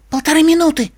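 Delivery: high-pitched, urgent reply; rapid high character voice; quick answer. A narrator's reading. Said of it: "быстро ответила Флаттершай".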